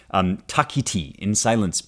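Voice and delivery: normal talking voice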